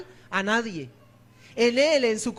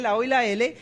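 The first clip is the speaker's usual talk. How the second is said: normal talking voice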